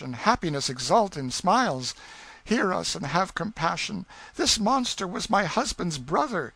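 high damsel in distress; melodramatic pleading. A narrator's reading. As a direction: beg for rescue in a high, theatrical voice. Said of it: "she cried".

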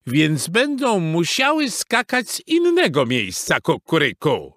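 high, cocky rooster voice; crowing rooster. A narrator's reading. Rooster answering Mrs Beaver, crowing. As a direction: crow the retort. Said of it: "zapiał Kogut".

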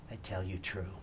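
ordinary delivery, nothing marked

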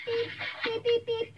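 high, even phone beeps; imitated dial beeps. Mother dialling the other mother's number, imitated beeps. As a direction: beep like phone keys being pressed.

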